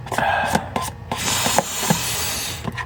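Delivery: long breathy exhausted sigh; heavy sigh. Real, not acted. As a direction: let out a long tired sigh. After eating raw onion.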